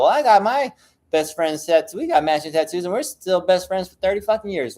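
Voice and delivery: smug challenger. Retold or imitated. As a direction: lightly imitate a know-it-all viewer boasting. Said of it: "someone's gonna see this video and go".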